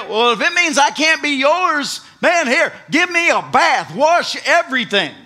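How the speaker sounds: loud, high rushed plea; eager. Retold or imitated. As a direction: blurt it out eagerly. Peter eagerly asking for bath.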